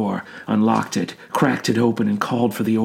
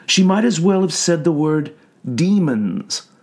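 ordinary delivery, nothing marked

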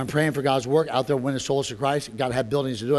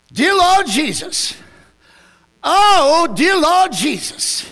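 praying under conviction; 9 semitones higher, 6 dB louder than usual. Retold or imitated. Lady under conviction praying about hell, wailing. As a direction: imitate a desperate all-night prayer.

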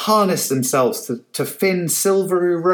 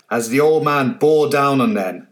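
ordinary delivery

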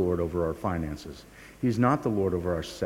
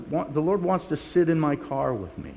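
normal talking voice

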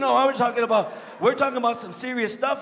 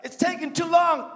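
loud, raised cry to the Lord; loud complaint; 5 semitones higher, 7 dB louder than usual. Acted. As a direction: cry out in mock complaint to God. Voices a complaint to God.

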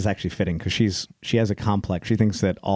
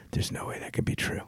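hushed whisper of shock; stunned whisper on waking; whispered disbelief; 11 dB quieter than usual. Retold or imitated. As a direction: breathe it out in disbelief.

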